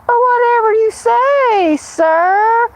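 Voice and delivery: high simpering meek voice; meek church lady. Retold or imitated. Mocking submissive church ladies to old men, high simper. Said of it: "old church ladies who are gonna lay back and say".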